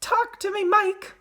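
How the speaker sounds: very high, pleading cry; frantic mother's plea; cried. A narrator's reading. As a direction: plead with your son in a high voice. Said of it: "cried Missus Teavee".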